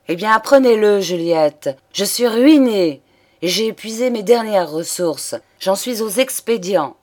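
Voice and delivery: loud, high outburst; losing patience. A narrator's reading. Exasperated lover confesses his ruin to Juliette, outburst. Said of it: "La patience échappa à Noël".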